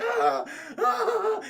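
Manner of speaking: high imitated yelps of pain; mock pained cries. Retelling Timothy's broken ankle, hammed-up cries. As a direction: yelp twice as if hurt. Hero's cry after breaking ankle.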